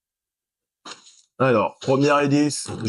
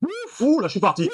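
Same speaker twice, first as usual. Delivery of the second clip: high, breathy gamer yelp; excited yelp; 12 semitones higher than usual. Real, not acted. Gamer reacting to a breakaway run, high yelp. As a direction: squeal as the play breaks loose. Reacting to his game play.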